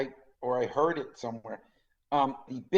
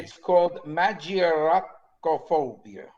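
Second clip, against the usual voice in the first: slow, Italian-accented "magioracophobia"; 3 semitones higher, slower than usual. Shown as a demonstration. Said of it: "Notice I put the Italian accent in there".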